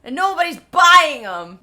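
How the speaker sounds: loud frustrated complaint, slowing; frustrated rant. Retold or imitated. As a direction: vent about the unsold plants. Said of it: "he's just frustrated because he's like".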